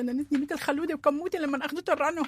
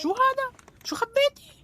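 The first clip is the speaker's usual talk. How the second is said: much higher, louder outburst; shocked scolding; 10 semitones higher, 5 dB louder, slower than usual. Acted. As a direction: catch her red-handed. Mother catches child hiding things.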